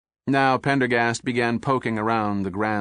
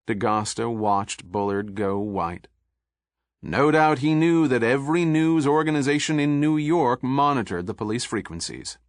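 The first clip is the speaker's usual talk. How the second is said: plain reading voice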